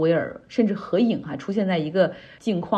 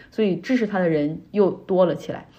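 ordinary delivery

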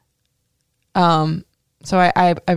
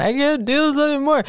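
high, mock crying plea; imitating herself crying to mom; retold crying; 9 semitones higher than usual. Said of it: "I would call my mom crying".

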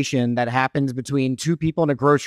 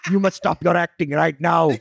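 put-on Indian accent; 2 semitones higher than usual. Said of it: "Why does he have an Indian accent?".

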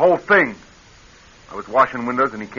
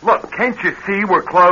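raised, annoyed call to the visitor outside; irritated call; 6 semitones higher than usual. Acted. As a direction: call out, fed up. Calling out again, irritated.

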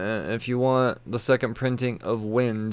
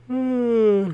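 high surprised oh; 13 semitones higher, 5 dB louder than usual. Real, not acted. Startled by a yawn.

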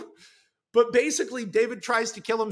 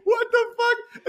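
slow, very high stunned outburst; shocked disbelief; 5 dB louder, slower than usual. Acted. Ex-girlfriend on the phone to David, shocked and high. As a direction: drag it out in disbelief.

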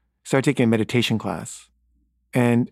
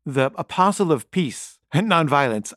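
ordinary delivery

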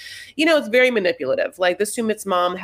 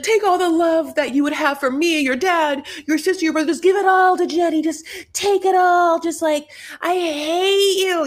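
angry disowning mother; 8 semitones higher, 3 dB louder than usual. Acted. Mom casting off her son, hammed-up angry rant. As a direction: play a furious mother, pitched high and stretching the hate.

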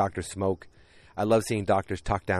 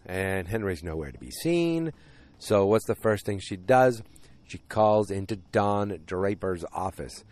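normal talking voice

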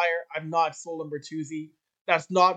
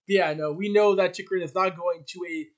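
ordinary talk

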